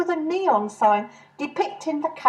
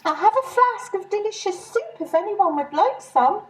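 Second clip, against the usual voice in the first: higher, sweet offer of soup; Mary's voice; 8 semitones higher than usual. Acted. Mary offering soup to the ladies, lightly voiced and higher. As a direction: play the eager, fussy friend. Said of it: "said Mary".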